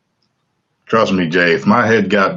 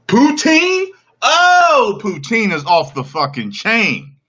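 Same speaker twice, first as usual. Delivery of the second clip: high, thrilled exclamation; real delighted outburst, high-pitched; excited rave; 13 semitones higher than usual. Real, not acted. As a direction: rave about it, pitch leaping way up. Raving about poutine to chat.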